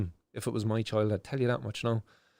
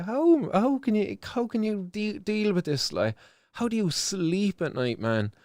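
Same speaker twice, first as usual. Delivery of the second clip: anguished plea; 6 semitones higher than usual. Real, not acted. Pleading anguish at minister.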